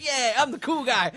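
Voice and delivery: high, loud, slow bragging; hammed-up self-satisfied boast; smug cool guy. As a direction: preen like you own the room. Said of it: "And I'll be like".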